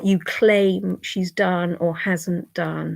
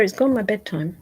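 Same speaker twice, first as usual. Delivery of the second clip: ordinary talk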